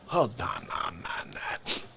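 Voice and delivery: soft breathy whisper, low and hushed; whispered aside. Acted. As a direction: drop to a whisper. Whispered aside after whoa-ho.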